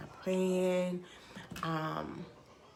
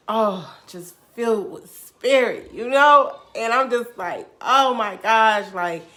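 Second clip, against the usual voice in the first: loud, raised, overjoyed outburst; overwhelmed elation; 5 semitones higher, 16 dB louder than usual. Retold or imitated. Storyteller reliving her encounter with God, loud elated awe. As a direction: burst out, filled with spirit. Reliving being filled with spirit.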